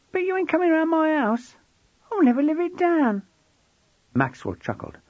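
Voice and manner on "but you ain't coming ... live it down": put-on high, louder indignant boy; defiant teen boy. A narrator's reading. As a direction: protest as the embarrassed boy. Voices George.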